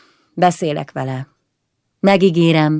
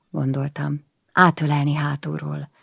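ordinary delivery, nothing marked

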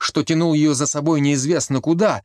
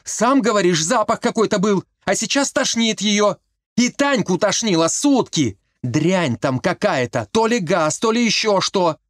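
high, urgent pleading; heated argument; 6 semitones higher than usual. A narrator's reading. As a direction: argue back hard. Mariyka keeps arguing heatedly.

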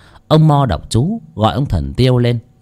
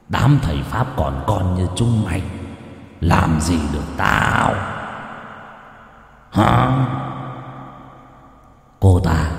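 demon voice; 2 semitones lower, 3 dB quieter than usual. Acted. Demon taunts the shaman.

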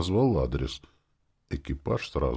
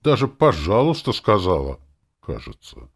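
offended demon; 2 semitones higher, 7 dB louder than usual. A narrator's reading. Demon insists she asked politely, offended. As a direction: protest sulkily, trail into doubt. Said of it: "заявила она обиженно".

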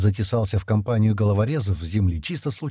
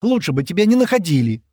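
heated, high-pitched lashing out; flaring up; 9 semitones higher, 5 dB louder than usual. A narrator's reading. Широ turns on the one who bothers her, angrily. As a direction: hurl it in a burst of temper. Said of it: "вспылила Широ".

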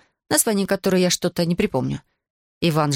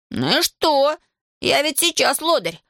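put-on higher-pitched boyish protest; defiant; 8 semitones higher, slower than usual. A narrator's reading. Schoolboy answers teacher calling him an idler, defiant. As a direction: argue back like a schoolboy, pitched up. Boy argues back at teacher.

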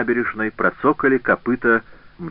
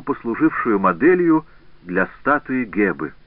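plain reading voice

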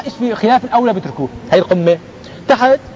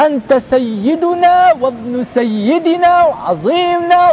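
high, drawn-out fawning proclamation; mock adulation; 8 semitones higher, much slower than usual. People hailing their leader with grand titles, mock adulation. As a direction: pile up the titles in a raised, imitating voice.

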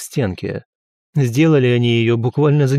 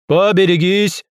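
raised, higher-pitched call to clear the way; warning shout; 7 semitones higher, 4 dB louder than usual. A narrator's reading. Porter warning people on the gangway, shouted. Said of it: "раздался крик".

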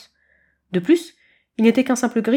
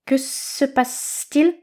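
slow hissing snakelet; 5 semitones higher, much slower than usual. A narrator's reading.

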